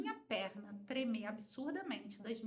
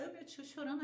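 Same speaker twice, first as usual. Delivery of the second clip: ordinary talk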